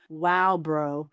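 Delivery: put-on casual teen drawl; lightly voiced teen brushing it off; teen slang. Shown as a demonstration. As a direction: dismiss it like a bored teenager. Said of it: "popular with my teens".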